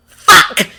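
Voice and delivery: sudden loud curse; shocked shout. A narrator's reading. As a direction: blurt it out in shock.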